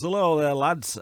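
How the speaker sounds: hammed-up northern English accent; a cheery northern English lad voice. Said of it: "I'd have an accent like this".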